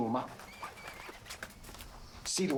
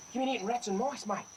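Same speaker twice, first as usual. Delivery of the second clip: coaxing the snake; 8 semitones higher than usual. Real, not acted. Talks fondly to cobra.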